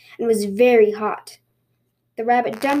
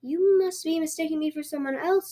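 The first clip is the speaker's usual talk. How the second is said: soft, higher innocent rabbit; feigned innocence; 6 semitones higher, 5 dB quieter than usual. Acted. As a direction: blink innocently and play clueless. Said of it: "said the rabbit, blinking".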